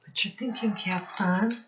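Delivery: plain reading voice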